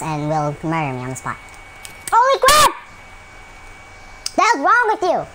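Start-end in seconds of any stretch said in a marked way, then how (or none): 2.1-2.7 high, loud startled yelp; startled shriek. Real, not acted. Gamer caught off guard by an enemy, startled shriek. Sudden game scare. 4.4-5.3 high, loud indignant yell; angry yell. Real, not acted. Gamer yelling at an enemy, angry.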